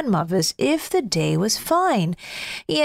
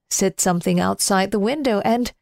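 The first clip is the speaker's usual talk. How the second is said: ordinary delivery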